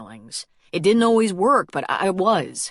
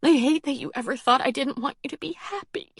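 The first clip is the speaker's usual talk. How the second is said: tearful whispered plea; crying whisper; 8 semitones higher than usual. A narrator's reading. Peyton, crying, tells her brother she wants him happy, whispered through tears. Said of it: "tears ran down her cheeks".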